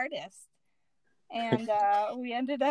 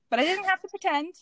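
amused; 8 semitones higher, 5 dB louder, faster than usual. Real, not acted. Compliment after laughter.

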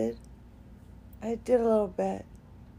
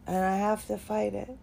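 ordinary talk

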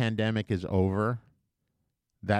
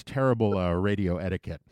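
ordinary talk, nothing marked

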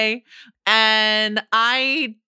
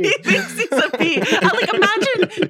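higher, laughing while quoting it; 10 semitones higher than usual. Real, not acted. Repeating the bee movie premise to the hosts, laughing. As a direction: crack up as you say it. Repeating the absurd line, cracking up.

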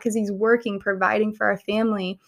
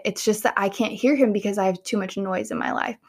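ordinary delivery

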